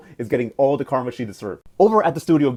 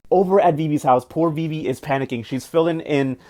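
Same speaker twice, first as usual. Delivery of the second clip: ordinary talk, nothing marked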